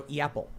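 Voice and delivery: "Yapple" as ee-APPLE; starts with E. Shown as a demonstration. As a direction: open "Yapple" on an ee glide. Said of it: "you start with an E sound".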